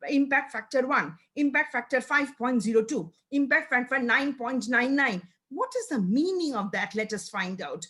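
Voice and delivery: normal talking voice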